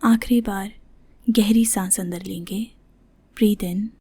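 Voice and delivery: soft, unhurried voice with long pauses; guided meditation. Shown as a demonstration. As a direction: gently guide them into the breath. Leads breathing meditation. (ध्यान कराती कोमल, ठहरी हुई आवाज़)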